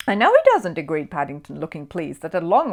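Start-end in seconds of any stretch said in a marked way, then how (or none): 0.1-0.8 Paddington's voice, pitched high and bright-eyed; high, eager little bear. A narrator's reading.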